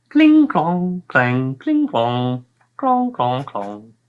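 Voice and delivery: high sing-song cling clong clang; clanking armour noises. Acted. Narrator mimics the knights' armour clanking.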